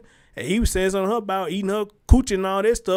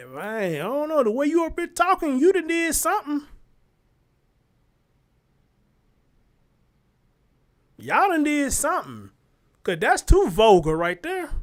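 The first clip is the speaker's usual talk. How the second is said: mock scandalized, pitched up high; retelling how he lectured his sister, voice pitched up high in disbelief; high scolding; 7 semitones higher than usual. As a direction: scold her like a shocked big brother.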